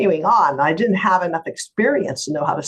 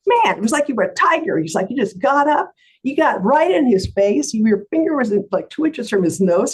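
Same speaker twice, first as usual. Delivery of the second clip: high, excited retelling of a client's awe; amazed; 8 semitones higher, 4 dB louder than usual. Acted. Client telling lawyer how she stood up, amazed and excited. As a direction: act out an impressed witness, pitch raised. Client marvels at her outburst.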